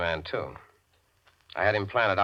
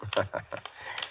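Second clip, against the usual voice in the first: soft mocking laugh; 8 dB quieter than usual. [name] mocks scared Lester.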